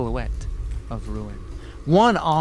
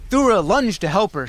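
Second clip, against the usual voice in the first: ordinary delivery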